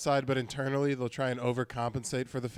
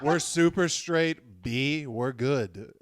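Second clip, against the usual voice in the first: imitating New Yorker; 5 dB louder, much slower than usual. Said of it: "imitating subway New Yorker".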